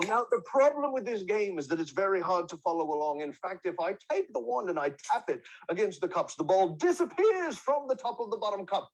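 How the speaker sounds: a theatrical Italian street magician working the crowd; Shakespearean street performer. Acted. Plays Francesco the street magician.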